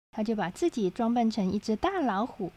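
usual speaking voice

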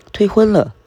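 high, loud startled repeat; a sudden loud cry of surprise; shocked. Acted. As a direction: blurt it out in disbelief. Uncle shocked by broken engagement.

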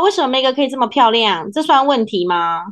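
ordinary delivery

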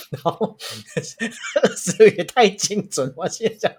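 amused disbelief through laughter; laughing. Real, not acted. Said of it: "蛮屌".

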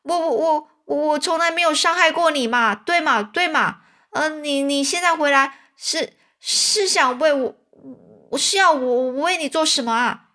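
high, stammering and frightened; trembling fear. A narrator's reading. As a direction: stutter it out, scared of a ghost. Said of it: "他发抖地对哈克说". (汤姆对哈克发抖地说，结结巴巴)

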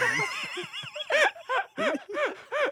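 squealing laugh, very high; high-pitched shriek of laughter. Real, not acted. Laughing at absurd story. (尖声大笑)

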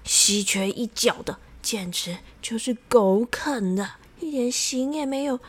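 ordinary delivery, nothing marked

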